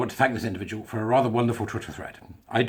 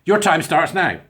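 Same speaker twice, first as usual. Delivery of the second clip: raised, loud quiz-host cue; mock quizmaster, higher and louder; Mastermind quizmaster; 4 semitones higher, 6 dB louder, slower than usual. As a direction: announce the start of a quiz round. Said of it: "rather like Mastermind".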